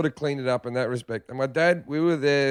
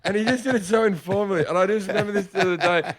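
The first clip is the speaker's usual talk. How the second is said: normal talking voice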